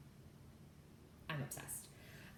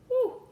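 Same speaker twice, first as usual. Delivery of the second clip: high swoony whew; 6 dB louder than usual. Real, not acted. Swooning over the Duke.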